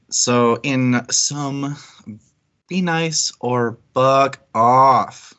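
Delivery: slow, deliberate, each word weighted; slow deadpan punchline. Acted. Host closing the community rules, slow deadpan punchline. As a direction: drag it out for the punchline.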